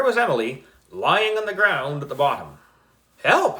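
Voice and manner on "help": high, loud cry; yelling for help. A narrator's reading. Trapped girl yelling up for help. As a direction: call for help from the pit. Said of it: "Emily yelled again".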